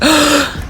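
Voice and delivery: sharp startled gasp; shocked gasp. Retold or imitated. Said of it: "I was shocked. I was like".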